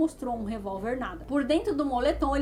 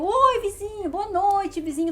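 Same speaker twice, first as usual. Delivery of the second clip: much higher, louder bright hello; overly cheerful greeting; 8 semitones higher, 5 dB louder, slower than usual. Retold or imitated. Victim to neighbour, over-eager louder greeting.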